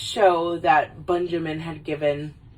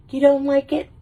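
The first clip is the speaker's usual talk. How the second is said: high, mock-hurt question; pitched-up girlfriend pique; put-out ex-girlfriend; 8 semitones higher, 4 dB louder than usual. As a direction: ask it high, acting wounded. Ex-girlfriend hurt by rejected gift.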